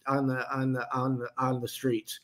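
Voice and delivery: flat robotic monotone, stuck repeating. Real, not acted. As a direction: sound like a stalled robot. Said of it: "God, I sound like a robot there".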